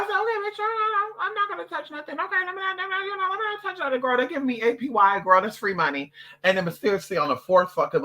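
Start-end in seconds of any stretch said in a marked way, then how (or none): 0.0-6.1 high, sugary put-on voice, playing dumb; mock innocent. To the banker, she plays along in a high mock-innocent voice. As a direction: squeak it out like a naive customer. Retold self, agreeing with banker.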